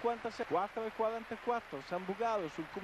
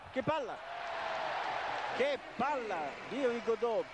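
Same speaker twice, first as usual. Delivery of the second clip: excited rising call; 3 semitones higher than usual. Real, not acted. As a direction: call it out in delight.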